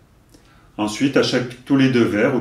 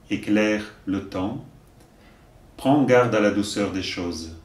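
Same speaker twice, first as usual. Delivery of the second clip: poem recitation. Acted. Teacher reciting a poem to learners, measured recitation. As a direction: recite each line deliberately, pausing between verses.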